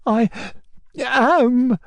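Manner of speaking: high, halting, sobbing answer; tearful high voice, broken between words; smothered sobs. A narrator's reading. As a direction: choke it out through stifled sobs. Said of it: "answered Fallalay with smothered sobs".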